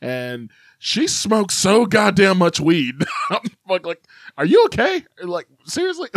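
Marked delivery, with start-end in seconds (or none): 4.4-6.1 high-pitched incredulous check-in; mock alarmed concern. Brother reacting to sister's heavy smoking, mock alarm. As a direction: ask it way up high, exaggerating the worry.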